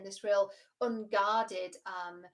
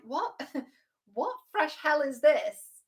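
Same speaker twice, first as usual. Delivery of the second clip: exasperated disbelief; 6 semitones higher, 8 dB louder, much slower than usual. Retold or imitated. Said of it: "shaking his head going".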